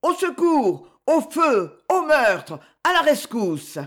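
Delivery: high-pitched cry of alarm; shouted calls for rescue; howling for help. A narrator's reading. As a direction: raise the alarm in a high, urgent yell. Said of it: "se mit à hurler".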